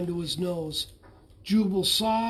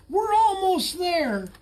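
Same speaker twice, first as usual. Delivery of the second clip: high, slow strained call; anxious call; 10 semitones higher, 4 dB louder than usual. A narrator's reading. Rescuer calling to the bullfrog, anxious high call. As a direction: call out, urgent and worried. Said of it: "an anxious voice called".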